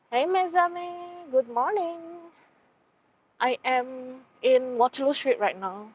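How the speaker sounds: high tour guide welcome voice. Acted. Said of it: "should I do my tour guide voice?".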